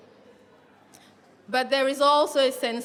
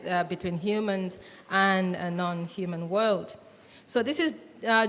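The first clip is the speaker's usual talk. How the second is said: usual speaking voice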